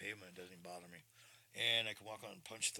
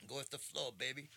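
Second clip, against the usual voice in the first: higher, breezy, laid-back; cool laid-back drawl; 4 semitones higher, 3 dB louder than usual. Retold or imitated. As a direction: say it loose and easy. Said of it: "I like how he said it".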